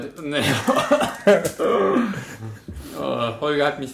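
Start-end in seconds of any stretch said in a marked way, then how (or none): 0.5-2.9 loud disgusted laugh. Real, not acted. Disgusted at smelling the cheese.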